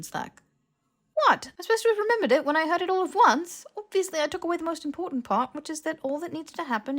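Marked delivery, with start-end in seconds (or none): 1.1-5.5 high, loud and defensive; exasperated protest. Acted. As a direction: throw the question back, indignant and loud. Harry defends himself, exasperated.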